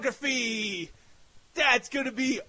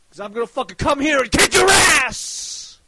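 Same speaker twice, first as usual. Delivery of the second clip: furious shout; 3 semitones higher, 6 dB louder than usual. Real, not acted. Threatening rivals.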